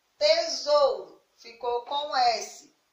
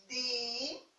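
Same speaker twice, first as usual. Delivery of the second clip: syllable by syllable; 8 dB quieter than usual. Shown as a demonstration. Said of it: "se a gente fosse separar a palavra".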